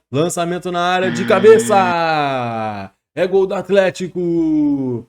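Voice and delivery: drawn-out goal call, slow; explosive goal commentary. Acted. Goal called like a TV narrator. (narração de gol explosiva)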